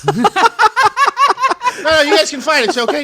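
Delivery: high and laughing, mock encouragement; mock teacher laughing out the okay. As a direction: giggle through a teacher's permission.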